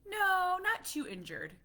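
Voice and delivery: put-on high loud little-boy reply; hammed-up chirpy little boy; kid voice. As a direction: answer brightly like a small child. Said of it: "And he's like".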